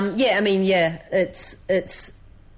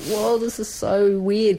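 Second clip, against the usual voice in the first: slow, higher, drawn-out amazement; amazed whoa; 4 semitones higher, slower than usual. Retold or imitated. Visiting an Indian farming family, slow amazed whoa. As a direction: marvel at how odd it all is, slowly. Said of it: "I was just like".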